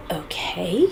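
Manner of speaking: breathy whispered okay, trailing up; barely voiced okay after being brushed off. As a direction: let it out under your breath, resigned. Resigned reply after being dismissed.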